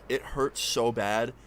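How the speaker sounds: ordinary delivery, nothing marked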